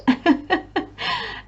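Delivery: airy amused titter; little giggle. Real, not acted. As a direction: giggle under your breath. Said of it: "I just have a little giggle".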